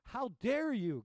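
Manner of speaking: high, loud royal outburst; angry shout. Acted. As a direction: bark it at the waves like an offended king. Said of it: "Canute shouted".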